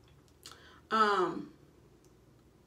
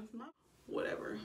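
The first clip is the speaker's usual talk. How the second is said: under her breath, dropping to a whisper; muttered aside; 3 semitones higher, 11 dB quieter, faster than usual. Real, not acted. Telling viewers it's her body, whispered aside. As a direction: toss it off in a whisper.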